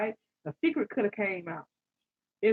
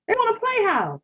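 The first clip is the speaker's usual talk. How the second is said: pitched high, teasing sing-song; sing-song taunt pitched high; mocking; 10 semitones higher, 5 dB louder than usual. Real, not acted. Mocking the partner's wish.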